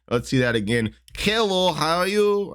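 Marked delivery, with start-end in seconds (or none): 1.2-2.5 high, mock Russian greeting; bad Russian accent. Fight analyst replaying the uppercut as a hello, bad Russian accent. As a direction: ham up a poor Russian accent, pitched high. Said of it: "can't do a really good Russian accent".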